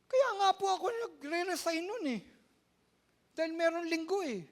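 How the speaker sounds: high, loud imitation of her; playful high falsetto quote; high mock voice of Ate Nicole. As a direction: squeak out her excuse. Retelling Ate Nicole's reply.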